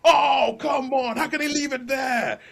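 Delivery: high squeaky sheep cry of protest, loud on the oh; frustrated sheep. Retold or imitated. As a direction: whine like a sheep robbed of the last chapter. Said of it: "and all the sheep going".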